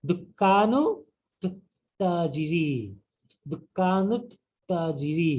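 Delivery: তুত্তাজিরি said slowly with a long held double t; slow, careful Arabic reading of তুত্তাজিরি; doubled t demo. Shown as a demonstration. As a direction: stretch the double t in দুকানু তুত্তাজিরি. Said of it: "এই জন্য এটা double উচ্চারণ করছি আমি".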